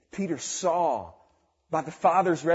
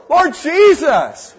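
slow, loud cry of Lord Jesus; calling out to the Lord; 11 semitones higher, 17 dB louder, much slower than usual. Retold or imitated. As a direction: stretch it out and call loudly to heaven. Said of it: "they call on the Lord".

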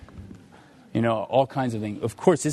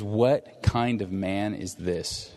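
slow, weighty quote; 4 semitones lower, much slower than usual. Acted. Preacher quoting a verse from Matthew, slowly and weightily. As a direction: draw out each word slowly, with weight.

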